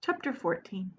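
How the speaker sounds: ordinary delivery, nothing marked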